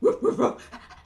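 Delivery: rapid breathy dog woofs; dog panting and barking. Acted. Storyteller plays the dog arriving, panting woofs. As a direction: pant and yap like a dog.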